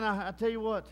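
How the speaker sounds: normal talking voice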